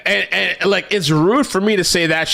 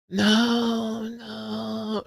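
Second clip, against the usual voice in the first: mock crying; 5 semitones higher, 8 dB quieter than usual. Mocking a liberal commenter, fake sobbing.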